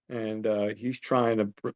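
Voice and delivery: ordinary delivery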